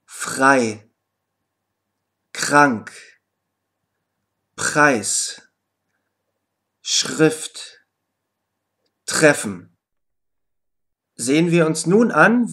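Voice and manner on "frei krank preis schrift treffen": „Frei“ mit stimmlosem, Ch-artigem R nach dem F; deutliche Aussprache. Shown as a demonstration. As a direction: jedes Wort langsam und klar zum Nachsprechen. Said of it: "Hör dir die Aussprache genau an". (Lehrerin spricht die Wörter zum Nachsprechen deutlich vor)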